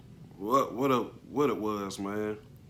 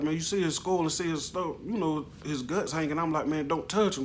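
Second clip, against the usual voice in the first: urgent pleading; 7 semitones higher than usual. Retold or imitated. Arguing with bystanders at crash.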